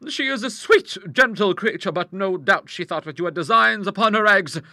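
high, loud, assured character voice; big-voiced matter-of-fact lady; strong-willed hostess. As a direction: explain it heartily and without concern.

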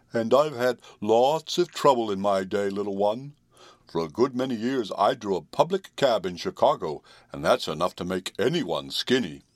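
put-on weary horse voice; old horse. A narrator's reading. Old horse explaining his skinniness to the children, weary. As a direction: recount troubles as a despondent old horse.

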